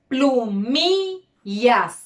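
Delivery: plumillas very slow, MI drawn out; slow teacherly plumillas with a long middle syllable; stress on penultimate syllable. Shown as a demonstration. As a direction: break plumillas into syllables, leaning on MI. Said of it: "en la penúltima sílaba tengo las palabras".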